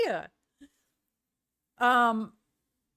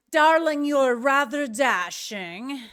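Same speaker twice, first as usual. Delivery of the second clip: posh darling drawl; 4 semitones higher, 5 dB louder, slower than usual. Pretend. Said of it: "you should have said darling before".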